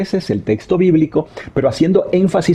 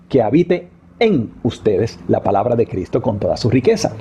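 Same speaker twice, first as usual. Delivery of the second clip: small word "en" clearly stressed. Shown as a demonstration. Teacher reading the verse aloud with "en" stressed. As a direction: lean on "en" in the verse. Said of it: "La tercera palabra entonces en este verso sería en".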